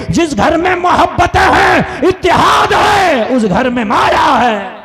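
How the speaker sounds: high shouted declamation, settling on the final phrase; full-force preaching. Real, not acted. Preacher telling the crowd where true wealth lives, full-force preaching. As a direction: drive the moral home at full power.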